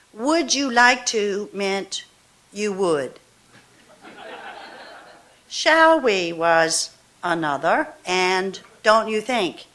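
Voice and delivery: slow, measured poetry reading; a poet reading her own verse slowly to the room; poem reading. Acted. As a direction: lay each line down slowly, letting the breaks breathe. Reads poem Lesson.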